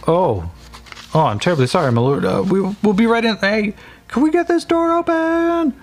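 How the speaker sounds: guard scrambling to open the door for his lord; guard flustered. Acted. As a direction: apologise fast, then shout to the others. Guard apologizing then calling others.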